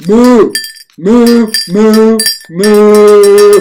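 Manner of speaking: loud, high cow moo. Acted. Did you finally want to say something.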